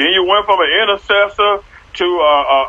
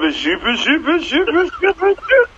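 climbing much higher with each repeat; teasing rising question; 8 semitones higher than usual. Acted. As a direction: tease them, pitch going way up at the end.

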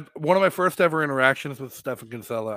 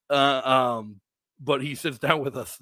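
usual speaking voice

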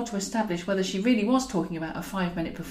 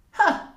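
scornful ha; 11 semitones higher, 6 dB louder than usual. Acted. Scornful laugh alone.

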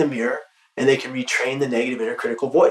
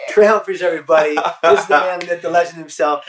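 announcer intro; 4 semitones higher, 5 dB louder than usual. Real, not acted. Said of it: "introducing guest to audience".

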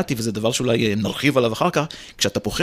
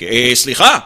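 teacher impression; 7 dB louder, slower than usual. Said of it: "הייתה עושה כ".

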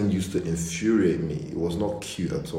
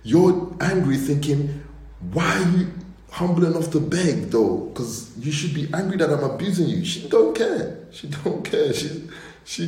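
exasperated; 9 semitones higher than usual. Retold or imitated. Baffled by her begging.

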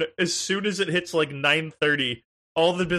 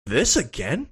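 deadpan game voice; slower than usual. Retold or imitated. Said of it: "His response".

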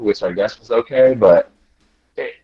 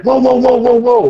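calling out; 6 dB louder than usual. Retold or imitated. Attendant stopping him leaving.

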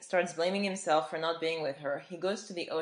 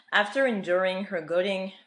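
ordinary delivery

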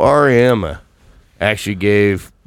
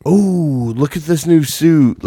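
higher-pitched imitation of fan hype; mock gushing, pitched up; mock excitement; 6 semitones higher, slower than usual. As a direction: ooh over it like an impressed fan. Mimicking hype over new suit.

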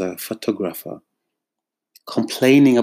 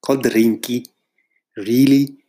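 put-on township accent, rising to a mock Really; 2 semitones higher, 3 dB louder than usual. Creative parroting a client's offer in that accent, mocking. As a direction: play up the accent, rising scorn. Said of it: "I'm even saying it in that accent".

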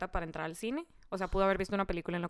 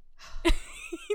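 high burst of laughter; real high laugh; loud laugh; 4 dB louder than usual. Real, not acted. Laughs loudly.

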